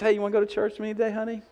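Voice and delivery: high, fast sweet invitation; lightly voiced gentle wife asking her husband.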